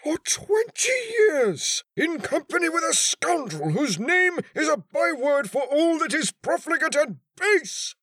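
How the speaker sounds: very high, loud tirade with stretched key words; high-strung agony. A narrator's reading. Betrayed husband accuses his wife, high-strung fury. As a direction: rail at her, voice pitched right up in anguish. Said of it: "in the high-strung accents which, in crises of great mental agony".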